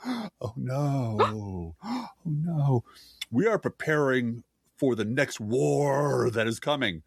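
gasping fake alarm, slow; hammed-up worried executive; mock panicked centrist. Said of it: "said Jonathan Cohen".

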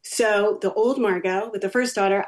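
ordinary talk

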